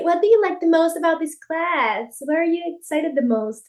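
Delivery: teacher asking the new student about the class; teacher's question. Retold or imitated. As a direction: ask it brightly like a teacher. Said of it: "otra pregunta de que".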